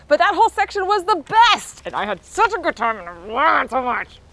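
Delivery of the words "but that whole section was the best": excited. Real, not acted. Raving about the ride.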